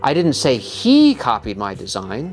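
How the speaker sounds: emphasis on "he", as if it was someone else; stress on HE. Shown as a demonstration. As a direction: hit the word he hard. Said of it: "I'll say the same sentence with different intonation".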